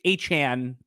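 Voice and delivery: Achane as "AY-chan", drawn out slowly; best-guess pronunciation. Shown as a demonstration. Co-host offering the version he knows, Achane as "ay-chan". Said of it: "I think every pronunciation's wrong".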